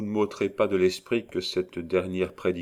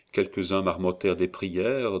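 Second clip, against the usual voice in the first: ordinary delivery, nothing marked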